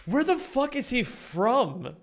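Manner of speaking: real bafflement, pitched high and drawn out; baffled outburst. Real, not acted. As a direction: blurt it out, high and incredulous. Baffled by the speaker's accent.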